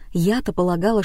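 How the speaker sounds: plain reading voice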